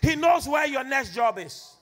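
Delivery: loud, rhythmic sermon declaration; forceful preaching. Acted. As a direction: proclaim it to the congregation.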